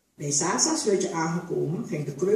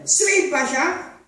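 much higher, loud and drawn-out call; urgent shout; 11 semitones higher, 8 dB louder, much slower than usual. A narrator's reading. A bushman shouts to the overseer to whip her. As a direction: egg the whip on.

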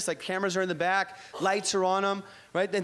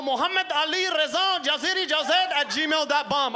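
put-on reading the email address in a slow Middle Eastern accent; 6 semitones higher, slower than usual. Comic reading the Al Qaeda email address in a slow Middle Eastern accent. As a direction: spell out the Iranian name slowly, mock-accented. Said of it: "get the accent across".

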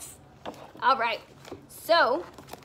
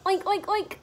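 put-on high, squealy oinking for the kids; pig oinks; 8 semitones higher, 5 dB louder, faster than usual. Shown as a demonstration. Teacher showing the class what a pig says, high playful oinks. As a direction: oink like a little pig. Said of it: "says the pig".

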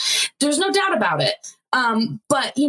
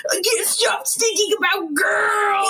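higher, slow whining cry; whiny wail; 10 semitones higher, slower than usual. Retold or imitated. Joseph confessing to Bobby, a hammed-up whiny wail.

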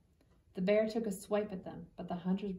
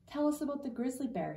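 begging; 6 semitones higher than usual. A narrator's reading. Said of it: "his cousins begged".

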